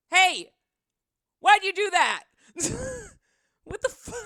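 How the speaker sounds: high, loud indignant cry; shocked protest. Real, not acted. Streamer protesting chat's surprise gift, loud and shocked. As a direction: call out in startled disbelief.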